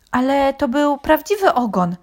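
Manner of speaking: high-pitched unguarded protest; blurted out. A narrator's reading. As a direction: let it slip out. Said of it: "wyrwało się nieopatrznie Reksiowi".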